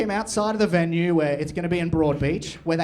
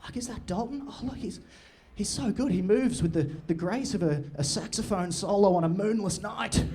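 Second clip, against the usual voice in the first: higher, softer breathless admiration; admiring classmates; 4 semitones higher, 3 dB quieter than usual. Retold or imitated. Classmates seeing him walk in, higher softer awed gushing. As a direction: gush like awestruck old classmates. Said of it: "They'll be like".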